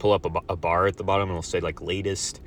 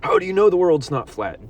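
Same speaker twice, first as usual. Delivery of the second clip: mocking know-it-all; 9 semitones higher, 5 dB louder than usual. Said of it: "Everyone thinks they're so smart".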